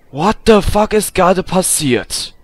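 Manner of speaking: loud, stunned outburst; loud, high disbelief; shocked. Real, not acted. Reacting to cars falling from sky.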